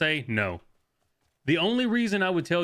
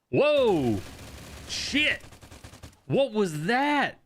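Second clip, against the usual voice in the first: startled; 7 semitones higher, much slower than usual. Real, not acted. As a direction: jump at the surprise. Enemy appears after freeze.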